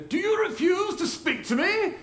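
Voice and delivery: put-on high, indignant British accent; John Cleese accent. Pilate to silent Jesus, affronted John Cleese-style British accent. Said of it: "like, like, John Cleese kind of accent".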